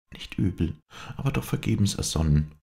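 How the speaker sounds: mildly scornful cry; dismissive exclamation. A narrator's reading. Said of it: "rief Clotilde das Blatt weglegend".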